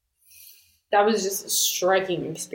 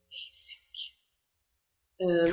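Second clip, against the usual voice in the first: very soft whispered confidence; whispered aside; 7 dB quieter, slower than usual. Real, not acted. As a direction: let it slip out in a whisper. Confides a private aside about him.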